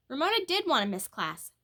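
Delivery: ordinary delivery, nothing marked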